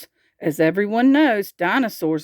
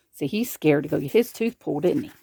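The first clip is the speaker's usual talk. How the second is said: ordinary delivery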